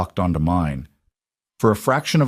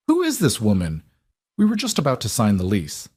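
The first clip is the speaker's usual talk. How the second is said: ordinary delivery, nothing marked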